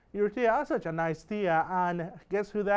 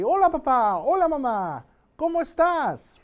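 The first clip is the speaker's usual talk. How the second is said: bright raised-pitch greeting, louder; lively greeting demo; 9 semitones higher, 8 dB louder than usual. Shown as a demonstration. Teacher models a greeting for students, raised and loud. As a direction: say it for the student to repeat, lifted and loud.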